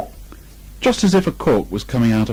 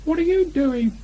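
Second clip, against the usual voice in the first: much higher small Piglet voice. A narrator's reading. Piglet triumphant to Christopher Robin, high and small. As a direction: squeak it out like a tiny piglet.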